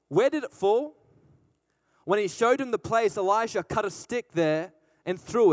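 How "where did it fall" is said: calm prophet question. A narrator's reading. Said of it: "The man of God asked".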